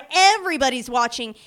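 normal talking voice

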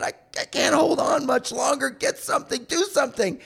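high, desperate cry for help; acted panic, straining high; frightened plea. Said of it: "I was frightened out of my mind".

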